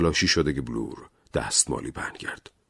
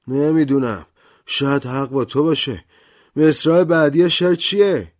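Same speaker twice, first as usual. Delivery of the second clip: higher, louder man's voice, unsure; a puzzled man asking, higher and louder; Blore's voice; 10 semitones higher, 6 dB louder than usual. Acted. (صدای بلور، بلندتر و زیرتر)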